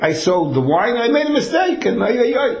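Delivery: high, wailing oy-oy-oy; yiddish accent. Retold or imitated. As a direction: cry out over the blunder. Seller laments mistake.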